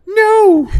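shrill high scream of refusal; man doing a woman's voice. Acted. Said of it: "I don't know how to do a girl voice".

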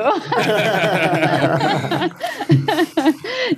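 bright high laugh at a compliment; laugh, flattered; delighted laugh. Real, not acted. Guest flattered by the host, laughing.

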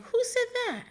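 high-pitched mock indignant question; very Portia. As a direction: squeal it like a reality star. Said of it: "It was very Portia".

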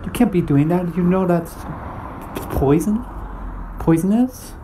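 high, shocked stranger; alarmed stranger. Retold or imitated. Stranger warns the mango eater, high and shocked. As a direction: shriek the warning up high. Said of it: "This guy come up to me and says".

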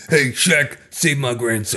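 put-on pleading old man; old master voice. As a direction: beg like a creepy old master.

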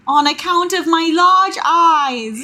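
put-on slow, high, loud little British child; British baby voice. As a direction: pipe up as a British tot. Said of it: "That's my British baby voice".

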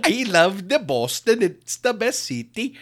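put-on high, laughing Boston accent; mock Boston accent. Friend gushing about Boston in a mock accent. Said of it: "That's maybe my favorite accent".

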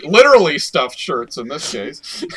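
usual speaking voice

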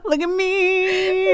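high, very slow squealed showing-off; shrieking jackpot winner. Pretend. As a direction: squeal it out like you just won. Showing off her swirling powers.

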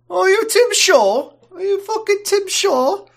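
very high, loud squeaky put-on voice; mock incredulous questioner. Crew questioning the villain, high-pitched mock incredulity. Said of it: "And they're like".

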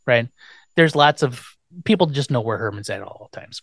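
ordinary delivery

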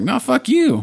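high, defiant retort; mock defiant Tulip. Tulip telling off fake God, high defiant retort. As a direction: Tulip snaps back at God.

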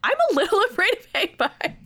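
high, fast, laughing admission. Real, not acted. Laughing while admitting she fears the monster. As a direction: laugh it out, voice jumping up. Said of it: "laughs on line".

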